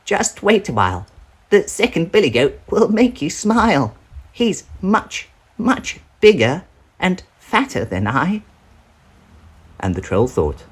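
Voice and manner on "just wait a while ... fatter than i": much higher, coaxing little voice; littlest billy goat. Acted. Littlest goat tempting the troll with his brother, high. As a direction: talk the troll into waiting.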